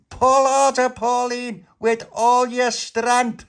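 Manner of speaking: high, loud, stretched-out yell; urgent shouted command. Acted. Little Pappy urging Pauline to pull, urgent shouted command. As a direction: roar her on, dragging the last words.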